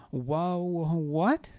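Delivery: groggy slurred voice. A narrator's reading. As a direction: slur it like a drunk. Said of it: "His voice was still slurred".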